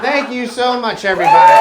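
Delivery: loud, high stage shout; shouting to crowd. Acted. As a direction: yell thanks over applause. Closing stand-up special to crowd.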